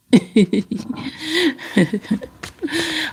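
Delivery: soft, high, drawn-out giggling; private giggle. Real, not acted. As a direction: giggle quietly to yourself. Laughing to herself while reading.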